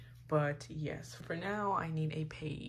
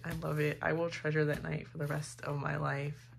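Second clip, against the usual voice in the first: ordinary talk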